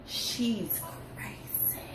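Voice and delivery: whispered under the breath. Real, not acted. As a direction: breathe it out quietly. Repeats it under her breath.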